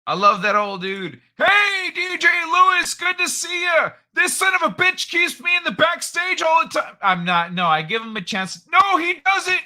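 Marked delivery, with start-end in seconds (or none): 1.4-7.0 put-on high, loud old-man character voice; Old Man Kai voice. Host ribbing his co-host, as a high, loud old man. 8.7-9.6 put-on high, loud old-man character voice; Old Man Kai voice. Host ribbing his co-host, as a high, loud old man.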